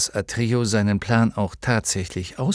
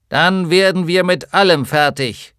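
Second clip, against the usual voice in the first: tinny giant voice; 8 semitones higher, 7 dB louder than usual. Acted. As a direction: speak like a hollow iron giant. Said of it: "mit blecherner Stimme".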